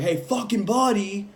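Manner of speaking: mock an exasperated call through glass; impatient calling out. Man banging on the truck window, impatient calling out. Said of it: "banging on the window at this point".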